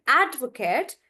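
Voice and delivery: "advocate" with a big AD, short ending; "advocate" as a noun, ending "kut"; stress on AD. Shown as a demonstration. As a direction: stretch the AD in "advocate". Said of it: "the stress is on ad".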